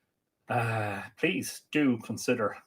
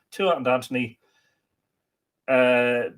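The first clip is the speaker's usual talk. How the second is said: ordinary talk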